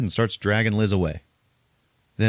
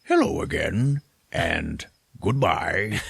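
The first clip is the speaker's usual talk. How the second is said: slow, stretched villain farewell; ominous Tall Man; slower than usual. Retold or imitated. Tall Man holding Liz by the throat, slow and menacing. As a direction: draw it out with mock menace. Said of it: "he just goes".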